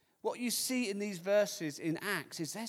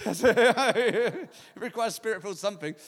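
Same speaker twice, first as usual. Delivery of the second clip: laughing at his own slip; louder, talk through genuine laughter; real laughing; 7 dB louder than usual. Real, not acted. Laughs at own forgotten words.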